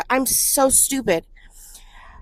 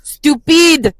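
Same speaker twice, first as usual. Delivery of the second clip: mock French accent; 6 semitones higher, 8 dB louder than usual. Hamming up French phrases.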